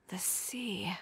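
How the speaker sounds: whispered to herself; soft murmur. Acted. Chiori whispering to herself as an idea forms. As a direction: breathe it out, lost in thought. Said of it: "What did you just say".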